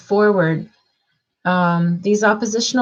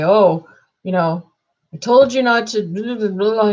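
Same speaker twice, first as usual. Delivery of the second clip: louder, slightly raised pretend telling-off; mock scolding; 3 semitones higher, 3 dB louder than usual. Parent to a little kid who misbehaved, mock scolding. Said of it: "and you scold and you say".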